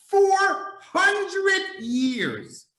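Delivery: "four hundred years" stretched very slow and high; preacher hammering the point slowly; drawn-out emphasis. Real, not acted. Preacher drawing out phrase.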